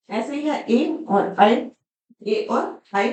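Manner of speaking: slow, careful ए then ऐ; vowel contrast. Shown as a demonstration. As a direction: pull ए and ऐ apart slowly. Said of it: "उच्चारण में ही कितना फर्क है".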